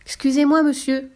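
a small devil politely hailing a shopkeeper; timid little devil. A narrator's reading. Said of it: "Le diable timidement frappa".

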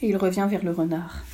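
usual speaking voice

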